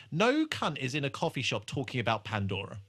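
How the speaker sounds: usual speaking voice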